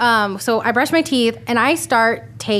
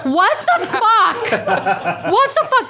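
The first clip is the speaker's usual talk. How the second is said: high laughing disbelief; laughing outrage; 9 semitones higher, slower than usual. Retold or imitated. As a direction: squeal it through laughter. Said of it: "I was like".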